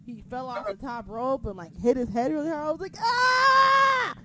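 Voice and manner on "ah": a high, loud scream of panic; retold scream. Fan watching the top-rope fall screams in panic. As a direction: shriek like you just saw him hit his head. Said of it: "I'm, like, screaming".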